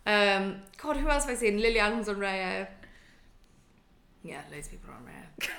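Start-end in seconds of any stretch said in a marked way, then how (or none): 4.2-5.5 whispered aside. Real, not acted. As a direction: lean in and whisper it like gossip. Gossip aside about Raya.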